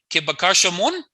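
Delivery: "mon?" rising high at the end; question inflection. Shown as a demonstration. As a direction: let "Ke bakasha mon?" climb up on mon. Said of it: "if you use inflection, you don't need it".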